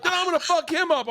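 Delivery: high mock threat. Road rager threatening.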